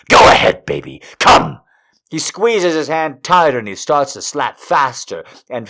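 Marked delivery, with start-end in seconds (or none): 0.1-1.6 urgent urging. Acted. Urgent command.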